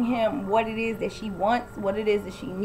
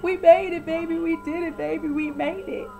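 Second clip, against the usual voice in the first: imitating her high, giddy, singsong chant; mock her wild, high cheering; manic giddy; 7 semitones higher than usual. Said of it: "And she's like".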